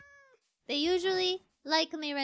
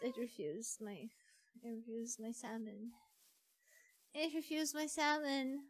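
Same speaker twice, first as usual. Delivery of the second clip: soft, low, deflated murmur; dejected; 7 semitones lower, 11 dB quieter than usual. Real, not acted. Player whose salmon the cat refused, dejected. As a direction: say it quietly, crushed by rejection.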